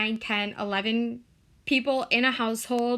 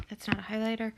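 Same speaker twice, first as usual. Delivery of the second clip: whisper; 6 dB quieter, much faster than usual. Real, not acted. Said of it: "aside whispered to self".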